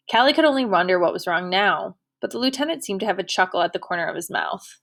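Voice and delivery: plain reading voice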